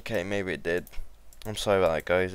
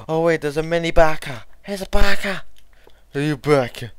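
high, loud excitement; excited shout; 7 semitones higher, 12 dB louder than usual. Real, not acted. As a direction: squeal it out.

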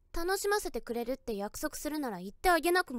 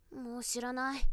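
ordinary talk, nothing marked

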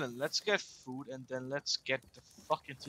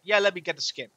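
mocking friend; 3 semitones higher, 9 dB louder than usual. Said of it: "you're like".

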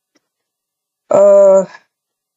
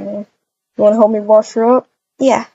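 usual speaking voice

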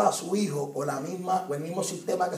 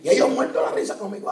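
laughing; 4 semitones higher, 4 dB louder, faster than usual. Real, not acted. Laughing telling roller coaster story.

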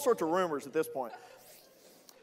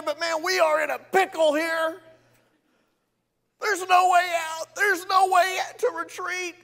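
high, loud fretting voice; anxious plea; 9 semitones higher, 7 dB louder than usual. Pretend. Moses privately confessing fear to God. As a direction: worry aloud to God. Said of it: "it goes something like this".

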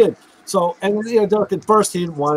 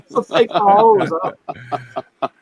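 laughing retort; 4 semitones higher, much slower than usual. Retold or imitated. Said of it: "I'm like".

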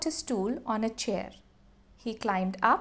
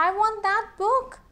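put-on high, loud child's demand; 10 semitones higher, 7 dB louder than usual. Anil insisting on the book to shopkeeper. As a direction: insist like a spoilt little boy. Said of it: "said Anil".

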